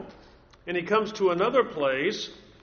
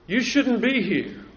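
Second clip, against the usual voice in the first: louder, firm repeat of the rebuke; God rebukes Elijah, the second time louder and firmer; stern rebuke; 6 semitones higher, 5 dB louder, slower than usual. Acted. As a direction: press it home like a scolding.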